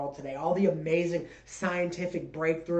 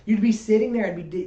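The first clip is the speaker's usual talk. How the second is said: ordinary talk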